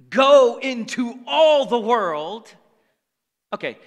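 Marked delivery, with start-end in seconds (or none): 0.1-2.5 loud, slow, drawn-out proclamation; proclaiming. Real, not acted. Preacher charging the congregation with scripture, proclaiming. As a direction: ring out the command, every word stretched.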